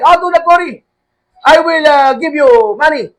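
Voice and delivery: calm him down like the cheerful caller; reassuring foreign caller. Retold or imitated.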